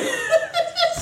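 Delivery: high squealing laugh. Real, not acted. Laughing at friend's reaction.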